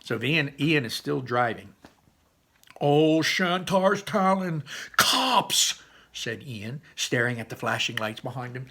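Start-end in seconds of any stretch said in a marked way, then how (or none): 2.8-5.8 high, loud, drawn-out exclamation; alarmed cry. Acted. Ian spotting the flashing lights, alarmed cry. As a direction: cry out in alarm, building to the last word.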